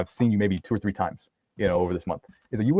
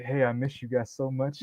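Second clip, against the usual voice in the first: mock tender; 3 semitones higher, 7 dB quieter than usual. Ex shows her chest, he speaks to it.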